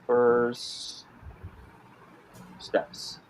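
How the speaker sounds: slow word-by-word typing dictation. Shown as a demonstration. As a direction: say each word as you type it. Repeats name while typing it.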